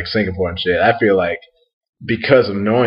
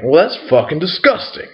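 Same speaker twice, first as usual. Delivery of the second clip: higher-pitched imitation of a disgusted bouncer; mock disgust; 8 semitones higher than usual. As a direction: act out someone appalled, pitch lifting. Bouncer's disgust acted in a retold encounter.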